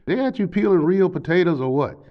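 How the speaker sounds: put-on high, rambling drunk questions; high chatty voice. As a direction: pitch it way up, babbling. Drunk stepdad yakking through door.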